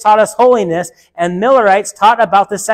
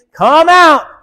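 urgent call out; 7 semitones higher, 5 dB louder than usual. Acted. Preacher urging people out of churches.

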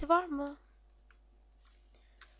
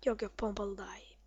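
whisper; 4 semitones lower, 7 dB quieter than usual. Real, not acted. Muttering to himself.

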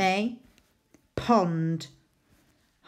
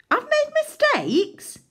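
very high, slow hammed-up wail; mock dismay; 5 dB louder than usual. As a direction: overplay the despair for the class.

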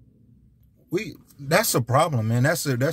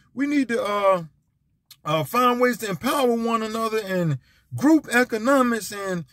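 mock preachy unity talk; 7 semitones higher than usual. Mocking hollow unity talk.

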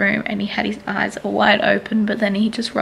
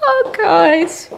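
laughing; 9 semitones higher, 4 dB louder than usual. Real, not acted. Laughing after baby moment.

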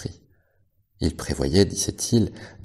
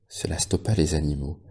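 ordinary delivery

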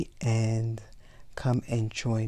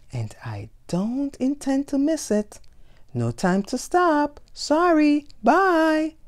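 high, rushed voice, louder at the end; a much higher tiger in a hurry; hurried tiger; 14 semitones higher, 5 dB louder than usual. Acted. Tiger hurries off to tea time.

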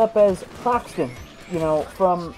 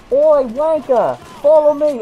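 mock British yell; 4 semitones higher, 7 dB louder, slower than usual. Yelled at by cosplayers.

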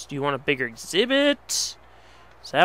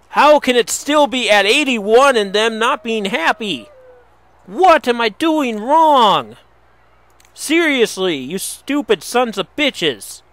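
much higher and louder angry rant; frustrated shouting; 7 semitones higher, 10 dB louder than usual. Real, not acted. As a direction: rant at the wolves, losing it. Raging at unhappy zoo wolves.

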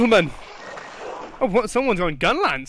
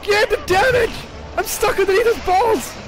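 frantic shouting; 13 semitones higher than usual. Real, not acted. Gamer yelling orders to teammates mid-fight, frantic shouts. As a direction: bark it out mid-fight in a panic.

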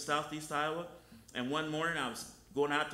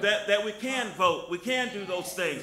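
loud, rising sermon voice, lines hammered out; full-force preaching; 6 semitones higher, 6 dB louder than usual. Acted. Preacher urging the congregation to love, full-force preaching. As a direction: preach it hard to the church.

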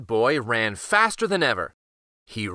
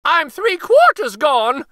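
high, loud cry of alarm; almost gone and panicking; high, loud desperate gingerbread voice; frightened wail; 12 semitones higher, 7 dB louder than usual. Acted. Gingerbread boy being eaten.

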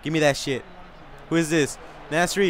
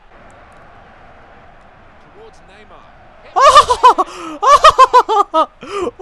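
long loud shrieking laugh; shouting laugh; 15 dB louder than usual. Real, not acted. Gamer howling with laughter after a planned goal. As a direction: howl with delight.

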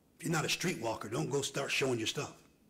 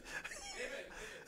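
high laugh; 12 dB quieter than usual. Real, not acted. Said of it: "all this screaming".

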